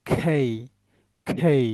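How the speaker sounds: খেই as "khey", puff after k; aspirated K. Shown as a demonstration. Teacher drilling letter names, খেই with aspirated k. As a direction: let breath burst after the k in খেই. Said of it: "কি বলবেন এটা?".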